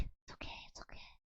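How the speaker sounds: whispered, fading to barely audible; hushed reassurance. Pretend. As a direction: whisper it like a secret. Hushing after a mistake.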